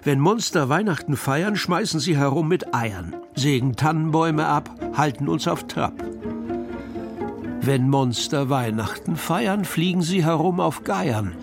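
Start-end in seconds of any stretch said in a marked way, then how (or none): none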